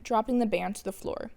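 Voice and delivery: plain reading voice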